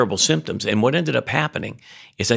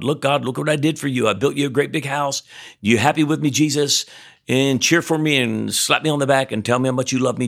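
higher-pitched, eager show-off act; mock boasting; 5 semitones higher than usual. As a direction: parody someone fishing for God's praise. Mimics believer boasting to God.

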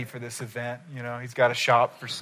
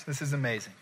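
ordinary delivery

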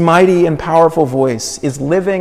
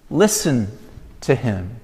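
hushed plea; 9 semitones lower, 11 dB quieter than usual. Acted. Preacher urging the congregation to listen, hushed and soft. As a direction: drop much softer and lower, almost to a hush.

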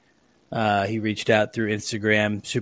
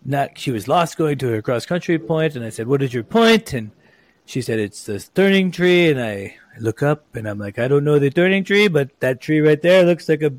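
a hammed-up but light Italian accent, louder toward the punchline; bad Italian accent; 3 semitones higher, 4 dB louder than usual. Host retelling the Italian examiner's check ride story, mock Italian accent. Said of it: "this is horrible Italian accent".

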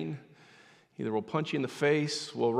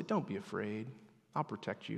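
soft, lowered bedtime voice; hushed fatherly promise; tender reassurance; 3 semitones lower, 9 dB quieter than usual. Retold or imitated. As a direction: soothe a frightened child quietly. Father reassuring frightened daughter.

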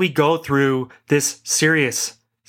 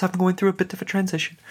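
mock English gentleman sending his regrets, raised pitch; a light, casual English accent, brushing it off; 5 semitones higher, 3 dB quieter, faster than usual. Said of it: "some English gentleman just sending his regrets".

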